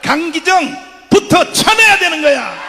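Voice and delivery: preacher calling the crowd to rise up; fiery rally preaching. Acted. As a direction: rouse the city, slow and loud. Rally sermon, full force.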